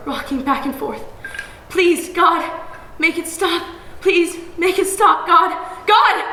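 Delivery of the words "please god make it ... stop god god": high loud desperate prayer; desperate plea. A narrator's reading. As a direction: beg God to make it stop. Girl praying amid violence.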